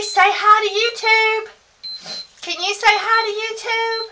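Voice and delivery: high coaxing sing-song for a little one. Real, not acted. Grandma coaxing baby Henry to greet viewers, high baby talk.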